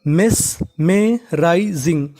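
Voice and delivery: मेस में rising drawn out slowly, syllable by syllable; teacher modelling मेस में rising at half speed; slow spelled-out demo. Shown as a demonstration. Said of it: "तो पूरी meaning".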